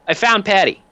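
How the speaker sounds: high and triumphant; cheerful thug voice. Acted. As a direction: proudly announce the find. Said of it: "he goes".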